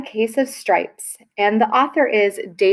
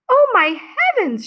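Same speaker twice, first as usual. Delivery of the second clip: much higher, alarmed mother's gasp of surprise; high, quick burst of dismay; shocked cry; 11 semitones higher, faster than usual. Acted. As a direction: cry it out like a startled mom. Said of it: "she cried".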